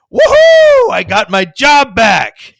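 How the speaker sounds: very high, loud, joyful shout; huge loud whoop of triumph; Homer's woohoo. Retold or imitated. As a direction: yell it like Homer celebrating. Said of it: "And Homer says".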